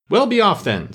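high-pitched, huffy dismissal; sulky. A narrator's reading. Pigeon sends Alice away from its nest, sulky. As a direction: send them away in a sulk. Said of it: "said the pigeon in a sulky tone".

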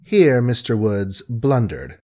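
ordinary delivery, nothing marked

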